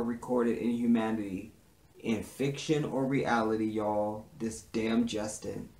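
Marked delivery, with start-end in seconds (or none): none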